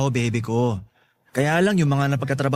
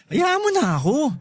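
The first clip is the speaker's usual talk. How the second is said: higher, slow, wheedling ask; playful pleading; 9 semitones higher than usual. Acted. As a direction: coax her to let him be. (malambing na pakiusap)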